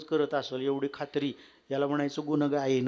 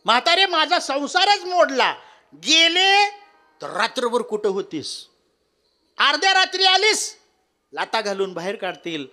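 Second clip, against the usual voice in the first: wailing daughter-in-law; 10 semitones higher, 9 dB louder than usual. Acted. God repeats wailing lament.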